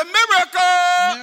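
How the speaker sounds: loud, high, drawn-out call; rallying shout. Real, not acted. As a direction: call it out for the crowd to echo. Calls congregation to repeat it.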